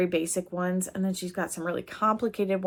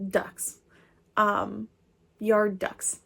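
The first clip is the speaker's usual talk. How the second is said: ordinary talk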